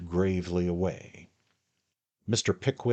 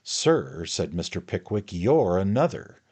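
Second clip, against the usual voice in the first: louder snapping retort; angry retort; 3 semitones higher, 8 dB louder than usual. A narrator's reading. Pickwick retorts to Tupman, loud and angry. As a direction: throw it straight back. Heated quarrel.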